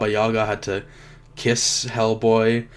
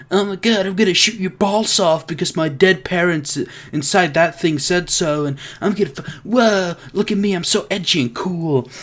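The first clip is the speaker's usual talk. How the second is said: higher-pitched mocking teen voice; edgy teenager; 5 semitones higher than usual. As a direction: put on a try-hard edgy teen. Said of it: "a edgy teenager in this movie".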